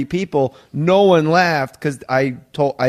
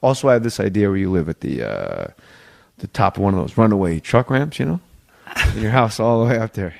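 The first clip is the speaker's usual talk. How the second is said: lower, deadpan pitching voice, lightly voiced; mock fake pitch; 8 semitones lower than usual. Comedy writer imitates a colleague's fake pitch, lower and deadpan. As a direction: sell an absurd idea straight-faced, low. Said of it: "do two fake pitches and go".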